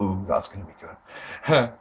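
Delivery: soft, slow, low ooh of anticipation; low, hushed crowd murmur of excitement. Retold or imitated. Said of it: "everyone in the room was sort of a".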